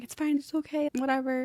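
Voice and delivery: high, light brush-off; putting on fine. Retold or imitated. Acting out brushing off others' sympathy.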